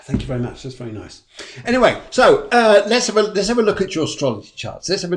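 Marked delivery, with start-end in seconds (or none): 1.6-5.2 bright restart. Real, not acted. Upbeat topic change.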